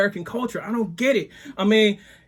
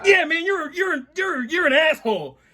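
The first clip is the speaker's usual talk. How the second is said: stammered insult; 7 semitones higher than usual. Retold or imitated.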